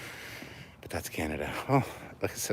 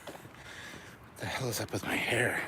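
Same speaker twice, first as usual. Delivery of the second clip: whispering; 8 dB quieter, slower than usual. Real, not acted. Muttering to self.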